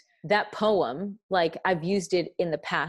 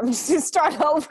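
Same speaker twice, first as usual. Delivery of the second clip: high, loud, laughing through the words; helpless giggly outburst; real laughing; 14 semitones higher, 7 dB louder, faster than usual. Real, not acted. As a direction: crack up while talking. Laughs at botched poem reading.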